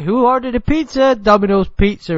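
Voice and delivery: high, loud pretend delivery man; mock pizza delivery. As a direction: call out a made-up pizza delivery. Acting an imagined pizza delivery excuse.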